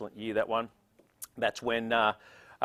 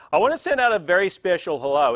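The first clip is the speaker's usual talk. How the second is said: louder, higher special hello; big shout-out; 6 semitones higher, 9 dB louder than usual. Real, not acted. Emcee greeting a guest by name, loud and bright. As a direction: beam out a greeting.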